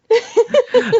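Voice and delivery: high, loud burst of laughter; real laugh. Real, not acted. Host apologizing to guest for the dogs, laughing. Laughing about the dogs' barking.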